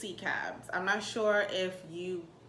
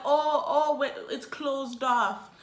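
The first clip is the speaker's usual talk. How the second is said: raised-pitch attempt at a Jamaican accent; mock Jamaican accent; 6 semitones higher, 5 dB louder, slower than usual. Cab driver making excuses, mock Jamaican accent. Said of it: "My Jamaican accent is not popping".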